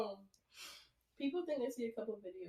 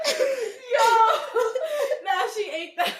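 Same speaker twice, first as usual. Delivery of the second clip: high, loud praise through laughter; cracking up as you cheer it; laughing hard; 13 semitones higher, 15 dB louder, slower than usual. Real, not acted. Co-host applauding friend's tweet, laughing hard.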